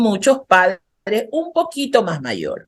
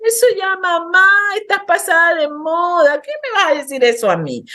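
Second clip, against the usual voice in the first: put-on higher, dismissive teen scoffing at mom; imitating teenager; 9 semitones higher than usual. Teen brushing off mom as old-fashioned, mock scoffing. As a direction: toss it off like a bored teenager.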